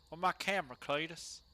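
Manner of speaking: ordinary delivery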